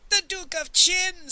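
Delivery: high, loud, drawn-out scream. A narrator's reading. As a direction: scream out the confession in terror. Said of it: "screamed Pawnbroker Fang".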